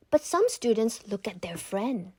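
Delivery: ordinary delivery, nothing marked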